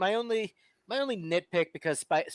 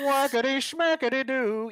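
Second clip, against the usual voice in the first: silly voice; 7 semitones higher than usual. Pretend. Silly nonsense exclamation.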